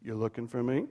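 a calm divine reply from above; voice of God. Acted. God's voice answering Job.

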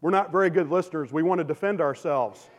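ordinary talk

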